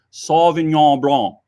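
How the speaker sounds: Sauvignon Blanc said slowly, French style; slow, careful Sauvignon Blanc. Shown as a demonstration. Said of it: "it is not the same thing as".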